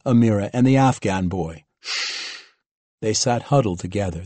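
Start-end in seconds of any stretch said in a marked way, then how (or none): 1.8-2.4 soft, drawn-out shush; a quiet warning shh. A narrator's reading. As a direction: hush them quietly. Lieutenant hushes captives with pistol drawn.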